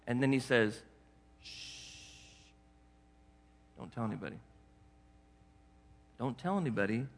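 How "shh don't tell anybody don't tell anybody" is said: much softer hushed warning; a hush, like a secret between two. Acted. Jesus telling the healed man to keep quiet, hushed.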